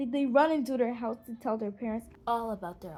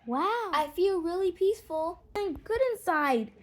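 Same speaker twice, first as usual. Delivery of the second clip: high, bright burst of excitement; a girl bursting with happy excitement; excited shout; 7 semitones higher, 3 dB louder than usual. Acted. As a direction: gasp it out, thrilled and glowing. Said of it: "Abena shouts excitedly".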